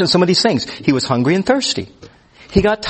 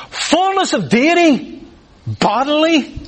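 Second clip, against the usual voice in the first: much higher, slow, marvelling repetition; astonished wonder; 10 semitones higher, slower than usual. Real, not acted. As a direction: say it high, as if hard to take in. Repeats the mystery verse in wonder.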